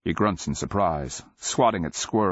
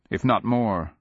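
usual speaking voice

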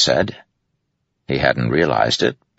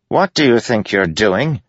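Yennet voice; 7 semitones higher than usual. A narrator's reading.

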